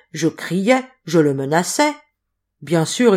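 ordinary delivery, nothing marked

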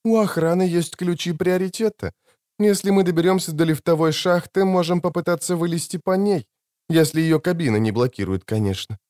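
high, unhurried voice at ordinary volume; calm Clarissa. Acted. Injured woman proposing an escape by elevator shaft, calm and high.

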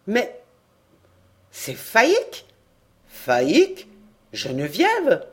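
rising surprised queries; puzzled guessing. A narrator's reading. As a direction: toss out the names in surprise. Villagers guess her name.

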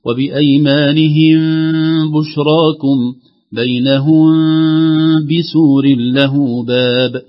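slow, loud chant, long hum on the meem of "وبأيمانهم" and "بينهم"; recitation with hidden meem. Shown as a demonstration. Sheikh models verses for listeners, hummed meem in "بينهم بسور". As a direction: hold the nasal hum before each ba in "بشراكم" and "بسور". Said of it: "هكذا".

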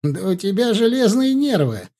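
high, loud mock amazement; exclaimed. A narrator's reading. Law student marvels at his friend's patience, exclaiming. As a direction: exclaim it loudly, pitched well up. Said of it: "воскликнул будущий юрист".

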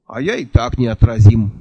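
usual speaking voice

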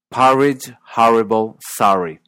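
"Porridge, horrible, sorry" as pahridge, harrible, sahry; open vowel. Shown as a demonstration. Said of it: "El primer sonido aparece".

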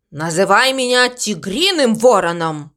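plain reading voice

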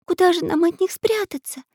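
put-on high-pitched girl's voice, anxious question; little girl asking. A narrator's reading. Nastenka asks friends where to hide, high girlish voice. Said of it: "спросила Настенька".

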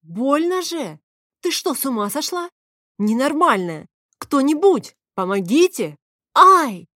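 high, loud cries for help; loud panicky protest; indignant wailing. A narrator's reading. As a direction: yelp in pain and outrage. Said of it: "возмущённый вопль".

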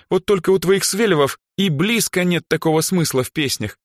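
high, derisive put-down; scoffing. A narrator's reading. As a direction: belittle her favourite band. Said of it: "хмыкнула Даяна".

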